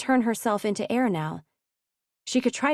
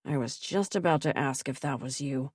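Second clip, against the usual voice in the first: muttered; 6 semitones lower, 5 dB quieter than usual. A narrator's reading. Archer aside during the standoff, dry mutter. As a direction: lower and softer, dry aside. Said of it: "Archer muttered".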